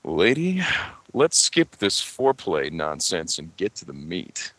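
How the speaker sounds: low, slow, soft radio-host voice. Pretend. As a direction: purr it low like a late-night DJ. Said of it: "in a very, like, you know, radio voice".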